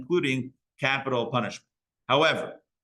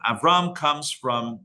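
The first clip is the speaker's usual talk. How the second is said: ordinary talk, nothing marked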